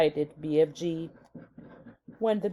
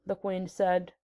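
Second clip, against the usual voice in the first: ordinary delivery, nothing marked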